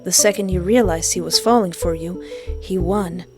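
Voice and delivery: normal talking voice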